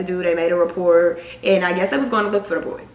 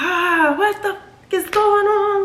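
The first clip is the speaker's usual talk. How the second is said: much higher, slower, mock-panicked dad; a dad losing it over the news; freaking-out dad; 12 semitones higher, slower than usual.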